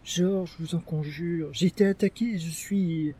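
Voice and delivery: high, soft begging; imploring. Acted. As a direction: implore him, voice lifted and thin. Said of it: "pour implorer".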